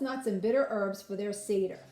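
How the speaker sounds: usual speaking voice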